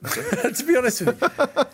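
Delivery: laughing through the words. Real, not acted. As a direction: chuckle as you say it. Laughing at own blunt answer.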